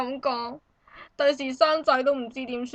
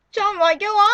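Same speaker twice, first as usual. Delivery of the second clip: ordinary talk, nothing marked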